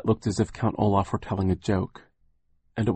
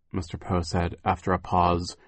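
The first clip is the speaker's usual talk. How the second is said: usual speaking voice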